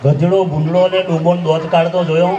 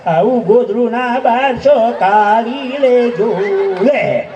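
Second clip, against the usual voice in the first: drawn-out, slow singsong lament; mock mourning wail; much slower than usual. Comic mimicking mourners crying over a mattress, mock wail. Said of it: "ગાદલું ઝાલીને રડશે પાછો".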